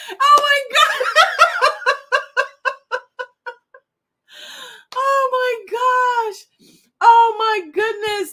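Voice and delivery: high, loud laughing exclamations; tears of joy. Real, not acted. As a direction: gasp it out through helpless laughter. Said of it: "I wanna cry tears of joy".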